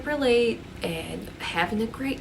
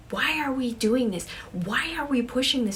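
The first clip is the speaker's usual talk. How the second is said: higher, louder agitated questioning; acted panic, pushing back at friends; freaking out; 5 semitones higher, 4 dB louder than usual. Sarah challenging the friends about the séance, freaking out. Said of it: "this makes Sarah just start freaking out".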